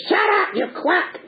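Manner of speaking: high, stretched puppet screech; furious Punch. Acted. Punch turns on the doctor, high mock-angry screech. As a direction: screech a hammed-up insult.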